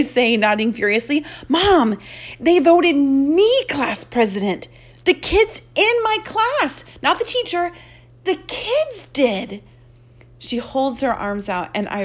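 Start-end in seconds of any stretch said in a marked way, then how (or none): 1.5-9.6 high, loud, beaming news; excited. Acted. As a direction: share big news, bursting with pride. Telling mom she won.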